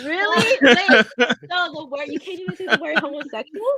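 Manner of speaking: high, laughing disbelief; shocked disbelief. Real, not acted. Outraged at being cut off.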